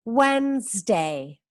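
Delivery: Wednesday said slowly as "wenzdei", silent middle d; teacher showing how the word really sounds; slow model. Shown as a demonstration. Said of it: "se pronuncia así".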